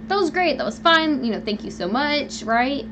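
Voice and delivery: sarcastic, high-pitched thanks; mock gratitude. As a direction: sneer out the thank-you.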